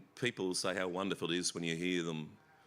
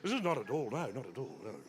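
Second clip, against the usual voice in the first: mock Ian Sinclair; 5 semitones higher than usual. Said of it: "I sound like Ian Sinclair".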